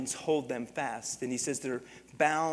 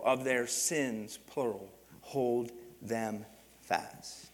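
drawn out and falling; solemn slow emphasis; slow, weighty reading; 3 semitones lower, much slower than usual. Shown as a demonstration. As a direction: let each word land heavily. Slowing to stress scripture.

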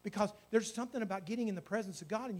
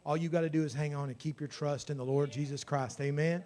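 normal talking voice

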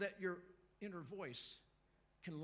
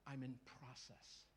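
quiet confession; 4 semitones lower, 10 dB quieter, slower than usual. Shown as a demonstration. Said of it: "Everybody needs to leave here going".

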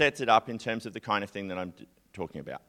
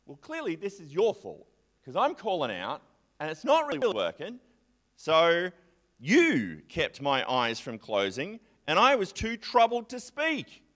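cross at God; 6 semitones higher than usual. Retold or imitated. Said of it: "he gets cross at God".

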